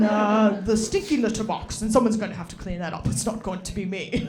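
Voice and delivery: put-on a light British accent, polite and posh-leaning; lightly voiced British accent, apologetic butler manner; Patrick Stewart British voice. Cat voiced as a British Patrick Stewart by his owner. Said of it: "my cat has a British voice of Patrick Stewart".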